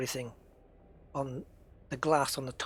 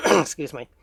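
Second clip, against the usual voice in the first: ordinary talk, nothing marked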